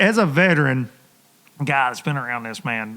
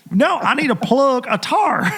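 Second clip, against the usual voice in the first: high, slow country twang, "tar" for tire; thick country twang; 8 semitones higher, slower than usual. Retold or imitated. Wesley explaining his flat tire to puzzled locals, in a laughing country twang. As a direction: drawl "No, I need to plug a tar" with a laugh in it. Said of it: "Wesley's countryer than all of us".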